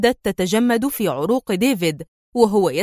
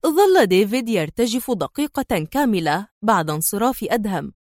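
plain reading voice

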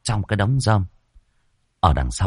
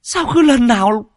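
put-on much higher, louder shrill young woman; shrill complaint; 5 dB louder than usual. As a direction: squeal in outrage at the intruder. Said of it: "choe choé vang lên".

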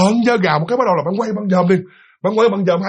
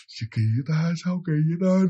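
scared murmur; 7 dB quieter than usual. Retold or imitated. Scared muttering to herself.

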